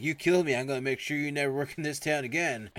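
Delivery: high, loud retort; mock angry threat. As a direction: fire back a threat in a staged quarrel. Acted quarrel, counter-threat.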